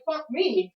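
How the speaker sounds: high, loud exasperated curse; retold angry outburst. Tenant retelling cursing back at the guard, angry and high. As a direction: throw it back at him in anger. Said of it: "You heard how angry I get".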